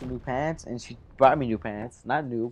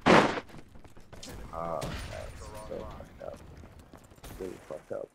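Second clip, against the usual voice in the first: muttered dismay; 6 semitones lower, 7 dB quieter, much slower than usual. Real, not acted.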